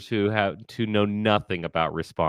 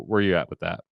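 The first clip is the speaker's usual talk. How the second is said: normal talking voice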